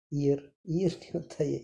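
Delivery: ordinary delivery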